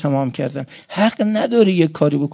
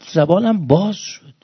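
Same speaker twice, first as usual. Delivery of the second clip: ordinary delivery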